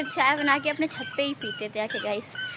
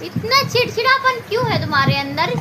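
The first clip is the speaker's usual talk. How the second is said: mock scolding; 8 semitones higher, 6 dB louder than usual. Real, not acted. Scolding toddler playfully.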